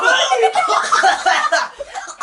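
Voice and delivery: shrieking laugh through the burn; helpless squealing laughter; pained high laugh. Real, not acted. After burning drink.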